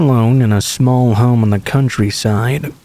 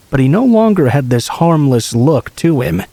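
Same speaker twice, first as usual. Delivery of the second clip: ordinary delivery, nothing marked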